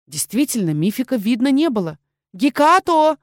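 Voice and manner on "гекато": high loud call into the alley. Acted. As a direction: call the cat's name loudly. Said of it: "позвала я".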